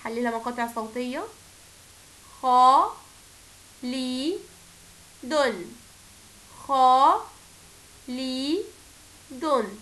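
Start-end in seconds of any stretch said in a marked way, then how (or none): 2.4-9.8 خالد broken as خا، لي، دن with a pause after each; syllable-by-syllable teaching demo; split into syllables. Shown as a demonstration. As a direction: tap out خا لي دن one by one. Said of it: "نقرأ مع بعض".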